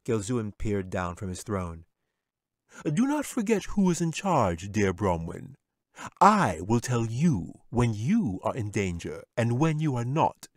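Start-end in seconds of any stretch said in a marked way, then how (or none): 2.8-10.5 a haughty wizard putting him in place; commanding wizard. Acted. Wizard asserts his power.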